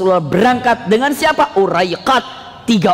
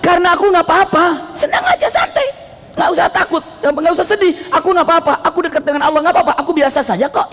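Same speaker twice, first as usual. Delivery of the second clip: higher, light and laughing, easygoing; cheerful reassurance; 8 semitones higher than usual. Acted. The Prophet telling Abu Bakar he is fine, light and laughing. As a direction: brush off worry with a laugh. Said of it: "Keren banget".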